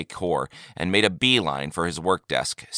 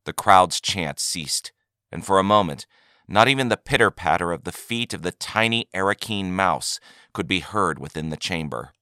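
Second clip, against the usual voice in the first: plain reading voice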